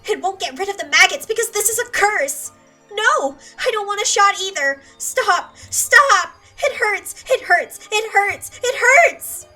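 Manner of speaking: loud, high panicked refusal; a girl screaming as she is held for a shot; hysterical screaming. Acted. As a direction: scream and fight off the doctor.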